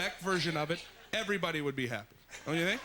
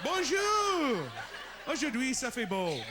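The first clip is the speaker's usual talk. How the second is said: French weather host; 6 semitones higher, slower than usual. Retold or imitated. Weatherman greeting viewers, high bright French. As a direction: chirp the forecast in French. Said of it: "They're like".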